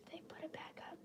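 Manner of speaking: hushed unvoiced aside to herself; whisper. Real, not acted. To herself while checking comments, whispered. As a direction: breathe the question under her breath.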